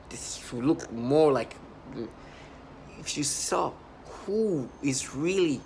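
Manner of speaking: very slow, drawn-out words; slow emphatic. Real, not acted. Telling viewers who the perps really are, slow and drawn out. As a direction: stretch every word out slowly.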